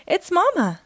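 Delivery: put-on light child voice, pleased; little sister. A narrator's reading. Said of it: "says sister".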